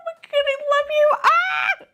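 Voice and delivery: very high, loud adoring squeal; overjoyed squealing. Real, not acted. Collector meeting the favorite cookie bear, loud squeal. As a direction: squeal with love, ending in a shriek.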